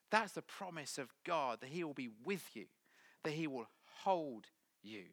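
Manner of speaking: normal talking voice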